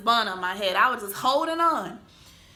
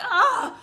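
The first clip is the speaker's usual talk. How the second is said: high panicked yelp; 14 semitones higher, 5 dB louder than usual. Retold or imitated. Stuck seatbelt panic.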